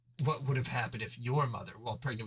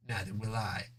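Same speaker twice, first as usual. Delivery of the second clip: ordinary delivery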